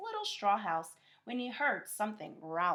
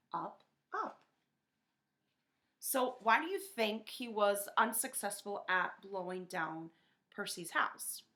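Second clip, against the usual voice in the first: ordinary delivery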